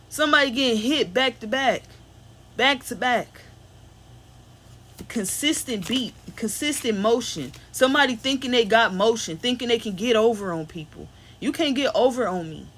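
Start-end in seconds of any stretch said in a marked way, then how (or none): none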